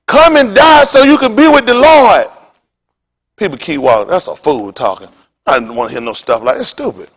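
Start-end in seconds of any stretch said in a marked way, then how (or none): 0.1-2.3 loud, high street-corner preacher cry; street preacher calling out. Retold or imitated. Street preacher calling out loudly to passersby. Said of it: "go find any preacher street".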